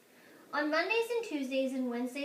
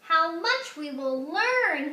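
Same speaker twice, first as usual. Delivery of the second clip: slow, much louder and higher; beaming headmaster dreaming big; thrilled principal; 5 semitones higher, 9 dB louder, slower than usual. Acted. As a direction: marvel at it aloud. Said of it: "he said".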